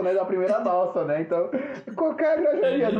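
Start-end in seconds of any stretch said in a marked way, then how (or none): 1.9-3.0 high-pitched bus tout call; mock street cry of the route; imitating cobrador's catchphrase. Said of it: "era a frase típica". (imitando bordão de cobrador, bem agudo)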